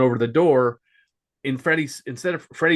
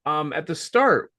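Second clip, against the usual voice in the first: normal talking voice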